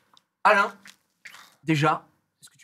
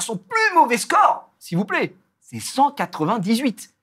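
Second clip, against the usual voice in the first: emphatique; 7 semitones higher, slower than usual. Real, not acted. Vante le score du joueur.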